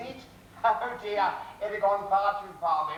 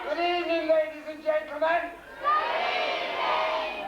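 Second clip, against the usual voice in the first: mock announcer; 11 semitones higher than usual. Goose greeting the pub crowd, hammed-up high announcer voice.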